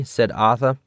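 plain reading voice

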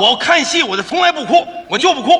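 loud, high-pitched protest; stubborn insistence. Acted. Straight man swearing he never cries at opera, loudly stubborn.

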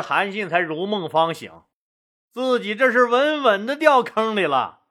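ordinary delivery

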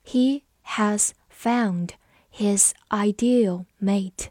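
slow, each word set apart; slow read-along. Shown as a demonstration. Teacher modelling the ideal mate sentence, slow read-along. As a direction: dictate it slowly to learners. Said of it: "好，我们慢读一遍".

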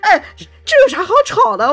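high, loud, laughing exasperation; laugh-crying lament. Real, not acted. Laughing at how silly the fight was, high and loud. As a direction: wail it through laughter.

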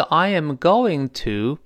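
slow "I am going to", each word full; slow repeat-after-me. Shown as a demonstration. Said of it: "老师在慢速跟读的时候".

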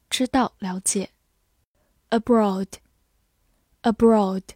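normal talking voice

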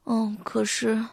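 drowsy, slow, trailing off into sleep; falling asleep. Acted. Arthur nodding off while talking to his toy, sleepy and slow. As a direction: let the words sink into sleep. Arthur dozing off mid-sentence.

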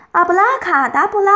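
high, loud magic chant; shouted spell. A narrator's reading. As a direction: call out the spell forcefully. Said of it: "大喊一声".

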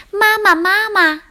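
high-pitched little tadpole calling Mama. A narrator's reading. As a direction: call eagerly after mother. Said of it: "就追上去喊".

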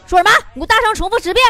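teacher snapping at whisperers, loud at the end; mock stern teacher. Teacher catching two students whispering, mock stern scold. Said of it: "老师看见了说".

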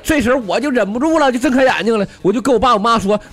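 high-pitched, breaking into speech; acted boyish agitation; excited outburst. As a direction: blurt it out as a worked-up kid. Boy bursts out in his retelling.

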